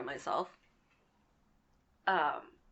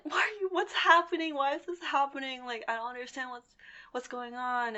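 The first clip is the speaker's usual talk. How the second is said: frightened questions pitched up, on the edge of tears; panicked, high, bewildered; 7 semitones higher than usual. Retold or imitated. To the scammer on the corner, high panicked bewilderment. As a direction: ask it like you're lost and scared.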